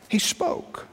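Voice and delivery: hushed reveal. Acted. Hushed answer about the serpent.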